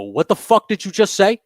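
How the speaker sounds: loud, high, furious confrontation; angry yell. Retold or imitated. Angry yell at the old man who called him an asshole. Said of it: "I go".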